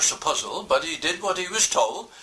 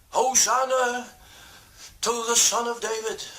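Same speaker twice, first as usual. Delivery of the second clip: crowd praising; 7 semitones higher than usual. Acted. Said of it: "They sang praises".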